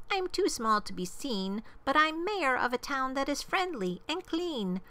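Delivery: high, proud little mayor; piping, thankful small-town official; tiny mayor calling. A narrator's reading. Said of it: "called the voice".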